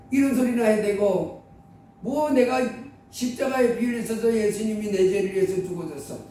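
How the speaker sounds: usual speaking voice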